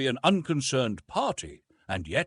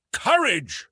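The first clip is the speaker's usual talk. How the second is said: louder, higher outburst; indignant cry; 8 semitones higher, 6 dB louder than usual. A narrator's reading. Nephew retorting to his uncle, indignant cry. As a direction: throw the word back at him. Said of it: "I cried".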